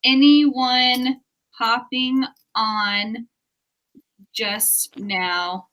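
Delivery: slow, spaced-out dictating; slow dictation. Real, not acted. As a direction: read it out slowly as you type. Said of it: "I'm gonna say".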